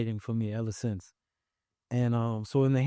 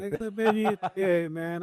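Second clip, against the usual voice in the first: higher, laughing through the line; 9 semitones higher than usual. Retold or imitated. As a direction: laugh at your own bad luck. Retelling fear of denial, laughing.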